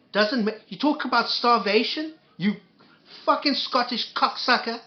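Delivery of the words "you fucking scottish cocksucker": angry insult. Real, not acted. Raging insult at the clip.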